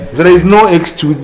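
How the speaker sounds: normal talking voice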